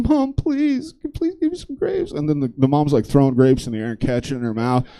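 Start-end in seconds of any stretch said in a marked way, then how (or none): 0.0-2.1 very high, thin whimpering plea; mock crying kid. Kid begging mom for grapes, hammed-up tearful whine. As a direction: squeak it out like a child in tears. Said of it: "like, crying now".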